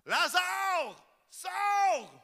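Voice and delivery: high, ringing command to the dead man; loud call. Acted. Preacher voices Jesus calling Lazarus from the tomb, high and commanding. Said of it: "il cria d'une voix forte".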